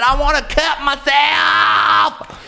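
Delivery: high, drawn-out mock tantrum scream; hammed-up rage. Pop star raging after losing her license, hammed-up shriek. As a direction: wail it slowly at the top of the voice. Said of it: "she went into a rage".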